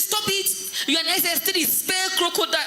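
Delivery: high-pitched mock scolding; lightly acted exasperated teacher. Teacher scolding a crying pupil.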